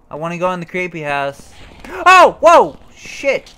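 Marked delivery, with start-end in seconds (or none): 2.1-3.5 loud high startled cry; jump scare. Real, not acted. Gamer hit by a jump scare, loud startled cry. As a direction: caught off guard by a scare.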